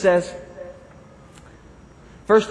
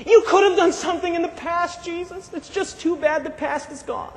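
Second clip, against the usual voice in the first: a touch of resigned disappointment, fading; mild mock regret; 6 semitones higher than usual. Martha to Jesus about the brother who died, mildly regretful.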